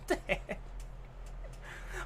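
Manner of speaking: voiceless breathy wheeze of laughter; silent laugh. Real, not acted. Streamer laughing at the name Nardong Putik, breathless silent laugh.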